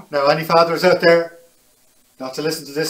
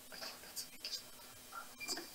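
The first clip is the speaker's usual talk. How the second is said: whisper; 29 dB quieter, faster than usual. Real, not acted. Embarrassed aside about a word.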